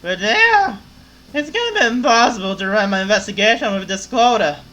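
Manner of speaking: loud, slurring tipsy journalist; ranting like she's had a few; drunk voice. Acted. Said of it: "to make the drunk voice".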